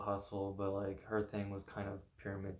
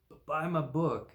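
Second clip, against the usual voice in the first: mock sales pitch; 7 semitones higher, 5 dB louder, slower than usual. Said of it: "they're like".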